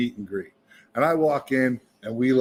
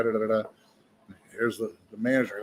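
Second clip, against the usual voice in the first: usual speaking voice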